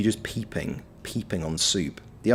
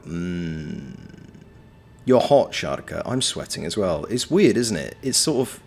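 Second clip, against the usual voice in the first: ordinary delivery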